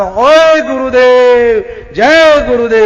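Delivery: loud, drawn-out hail, pitched high; disciple's answering call. Acted. Hidden disciple answering his guru, calling out loudly. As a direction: call back to the guru, stretching the vowels.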